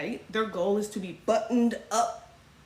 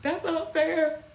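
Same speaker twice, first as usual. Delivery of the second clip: mock complaint; 8 semitones higher, slower than usual. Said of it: "they're just like".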